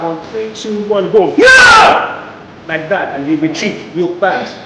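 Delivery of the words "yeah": high full yell; battle shout. Real, not acted. Coach leading the punch with a full yell. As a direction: hit it with your voice. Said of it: "scream that".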